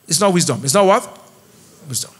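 ordinary delivery

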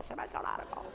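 "se me ha hecho largo" breathed as a confided whisper; whispered aside. Shown as a demonstration. Repeats the line under breath.

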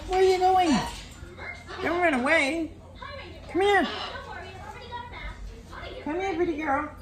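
coaxing a bird in a very high sing-song voice; squeaky high coaxing, calling a bird over; high pet baby talk. Acted. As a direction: owner coaxing her parrot onto her lap, very high baby talk.

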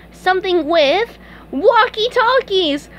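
high, loud, drawn-out reveal; excited idea. Acted. SpongeBob proposes walkie-talkies to Patrick, excited. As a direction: unveil it slowly and gleefully.

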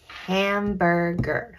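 "hamburger" slowly, split ham-bur-ger; measured "hamburger" in three separate beats; sounding out syllables. Shown as a demonstration. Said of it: "Let's talk about our syllables".

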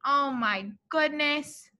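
ordinary delivery

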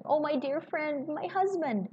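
put-on Lucy imploring her old friend about her husband. A narrator's reading. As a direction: beg him for help, desperate.

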